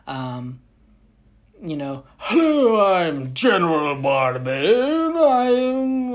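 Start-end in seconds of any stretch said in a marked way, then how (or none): 2.2-6.1 loud, high hammed-up posh adventurer; pompous explorer. Vlogger imitating the ride's explorer character, hammed-up. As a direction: announce yourself like a grand explorer. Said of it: "he's like".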